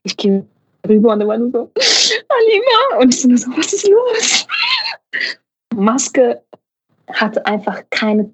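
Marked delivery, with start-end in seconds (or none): 2.3-3.0 high, wailing call of her name. Retold or imitated. Crying colleague calls her name. 3.6-4.5 high, alarmed shout. Retold or imitated. She rushes over, alarmed.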